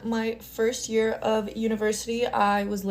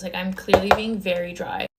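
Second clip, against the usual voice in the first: ordinary talk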